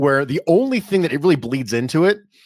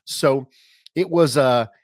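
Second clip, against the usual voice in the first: ordinary delivery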